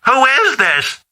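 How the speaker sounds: put-on high, confused old mother; mom on the phone. Mother not recognizing her son's voice, confused. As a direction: sound lost and unsure who's calling. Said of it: "She goes".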